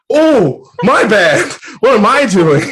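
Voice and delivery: high, loud mock-embarrassed outburst; mortified realization. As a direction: exclaim it as if caught in a blunder. Acts out realizing his own blunder.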